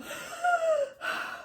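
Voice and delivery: high, drawn-out gasp; dramatized choking gasp; imitated gasp. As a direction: suck in air as if about to pass out. Acts out gasp before passing out.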